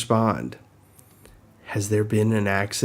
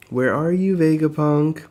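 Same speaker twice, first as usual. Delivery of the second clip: calling out; 6 semitones higher, 4 dB louder than usual. Acted. Calling out for Vegapunk.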